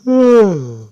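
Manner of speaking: loud, high stretching groan; waking stretch groan. Retold or imitated. Asta waking from his nap with a loud stretching groan. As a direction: groan it out like you just woke up. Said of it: "He's like".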